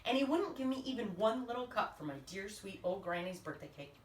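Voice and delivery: ordinary delivery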